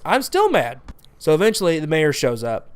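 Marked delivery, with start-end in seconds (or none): 0.0-0.8 pitched high with real irritation; still mad. Real, not acted. Reviewer insisting the movie still angers him, exasperated high. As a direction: spit it out like the anger never left. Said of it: "You can hear it in my voice".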